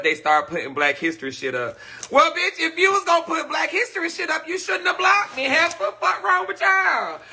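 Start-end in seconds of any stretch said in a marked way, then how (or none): 2.1-7.2 exasperated squawk, much higher; high-pitched indignant rant. Real, not acted. To the page that blocked her, high indignant outburst. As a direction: pitch way up, venting disbelief at them.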